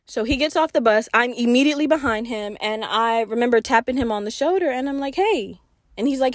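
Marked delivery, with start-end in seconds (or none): none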